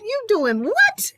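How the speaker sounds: high, drawn-out disbelief; incredulous. Retold or imitated. Reacting to a friend's Washington news, incredulous. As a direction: stretch it out high, re-enacting your shock.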